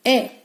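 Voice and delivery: ए said as short 'eh', as in bet; short phonics ए for letter E; short E sound. Shown as a demonstration. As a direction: give ए the clipped vowel of 'bet'. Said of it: "जो इसका short sound है".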